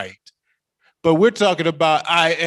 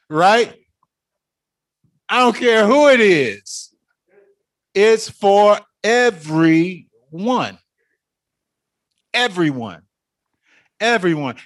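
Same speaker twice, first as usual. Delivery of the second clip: emphatic preaching; 6 semitones higher, 6 dB louder than usual. Real, not acted. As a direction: proclaim it, draw out the end.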